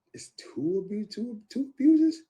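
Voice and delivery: high, loud, slow gasp of disbelief; stunned disbelief. Retold or imitated. Hearing of her two abuses, shocked high disbelief. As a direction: repeat it like you can't believe your ears.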